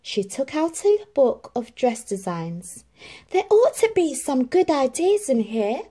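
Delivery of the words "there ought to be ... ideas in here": higher, louder, keen; Cinderella musing. A narrator's reading. Cinderella looks through dress designs, eager. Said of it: "she said as she turned the pages".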